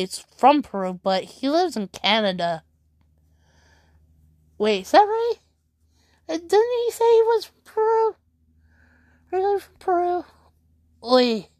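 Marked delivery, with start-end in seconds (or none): 4.6-10.2 high, incredulous double-take; puzzled surprise. Real, not acted. Streamer suddenly doubting what he just read, high and puzzled. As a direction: catch yourself doubting mid-thought. Confused double-check of his origin.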